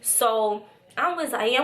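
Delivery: ordinary talk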